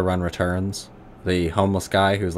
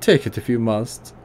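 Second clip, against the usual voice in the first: put-on gruff German accent, pitched higher; 3 semitones higher than usual. Old tinkerer greeting the player in a higher gruff German accent. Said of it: "high German voice".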